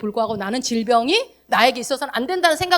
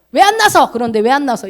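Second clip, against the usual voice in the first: nagging mock question; 7 dB louder than usual. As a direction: needle them loudly. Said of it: "저같이 옆에서". (옆에서 따지듯 큰 소리로)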